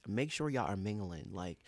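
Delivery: normal talking voice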